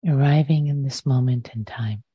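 unhurried meditation guidance with long pauses; guided meditation. Real, not acted. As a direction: guide listeners into the breath, leaving space after each phrase.

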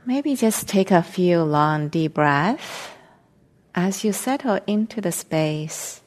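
slow meditation guidance with long silences between phrases; guided meditation. Real, not acted. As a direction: ease them into stillness, phrase by phrase, unhurried.

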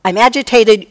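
loud, heated I'M AGITATED, blurted out; reactive outburst. Shown as a demonstration. Teacher showing a reactive blaming outburst, loud and heated. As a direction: snap it out as if someone else caused it. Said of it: "And not immediately say".

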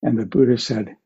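ordinary delivery

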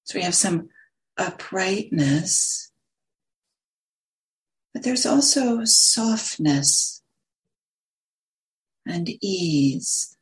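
unhurried meditation guidance with long pauses; lingering on words, leaving long silences between phrases; guided meditation. Acted. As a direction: guide listeners gently into stillness, phrase by phrase.

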